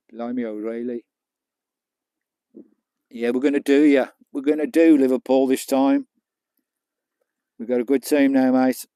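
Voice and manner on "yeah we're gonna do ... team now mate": loud taunt. Real, not acted. Football banter, taunting rival fan.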